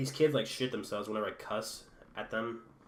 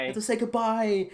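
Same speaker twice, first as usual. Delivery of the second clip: raised, high-pitched urging; mock insistence; 11 semitones higher, 8 dB louder than usual. Classmates messing with a believer, mock insistent. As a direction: egg him on loudly, imitating the classmates. Acted taunting of the boy in story.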